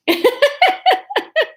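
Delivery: high giggling; helpless laughter. Real, not acted. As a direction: laugh at your own reading. Laughs at own reading.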